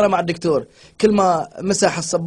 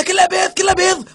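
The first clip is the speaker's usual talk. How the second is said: high excited; 9 semitones higher than usual. Retold or imitated. Stoner beating a TV.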